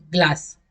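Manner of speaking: ग्लास said easily with a short aa; unstressed ग्लास, vowel kept short; easy, unforced. Shown as a demonstration. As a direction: relax into a brief ग्लास. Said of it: "आराम से".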